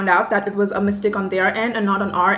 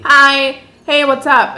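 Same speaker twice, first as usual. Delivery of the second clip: higher, louder imitation of a teenage boy; sixteen-year-old boy; 4 semitones higher, 6 dB louder, much slower than usual. Teenage host answering the phone, casual boy imitation. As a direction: lightly mimic a casual teen. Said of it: "it's, like, a, a sixteen-year-old boy".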